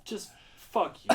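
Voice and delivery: high, loud acted-out outburst; exasperated retort. Staffer's retold reaction to a rude diner, exasperated retort. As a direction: let the pent-up annoyance burst out.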